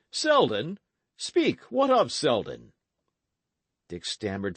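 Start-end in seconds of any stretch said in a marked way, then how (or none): 0.1-2.7 urgent, higher and louder demand; alarmed cry. Acted. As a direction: knight demanding the news in alarm. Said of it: "he cried in a changed voice".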